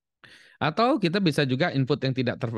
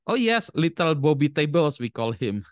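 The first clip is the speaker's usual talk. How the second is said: higher, slow, cheerful mock mum; proud mother; 6 semitones higher, 4 dB louder, slower than usual. Mother names her son Bobby Tables, mock doting. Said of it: "Kata ibunya".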